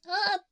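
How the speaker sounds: high, sudden cute yelp; cute startled cry. Acted. Heroine touched on the forehead, a high cute yelp. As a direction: squeal as if touched by surprise. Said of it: "すげえ可愛い声出ちゃった".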